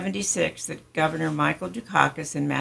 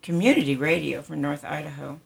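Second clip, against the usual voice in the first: ordinary talk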